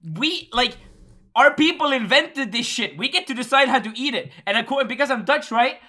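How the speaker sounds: high-pitched, emphatic lecturing; insistent rant. Real, not acted. Dutchman claiming the right to rule on fries, insistent rant. Keeps ranting about fries.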